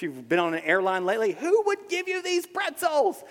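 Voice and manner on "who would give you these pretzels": very high, loud mock outrage; hammed-up complaint held high; indignant passenger. Preacher mimicking an airline passenger, high mock indignation.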